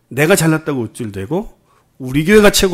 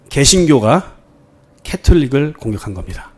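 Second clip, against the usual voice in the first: usual speaking voice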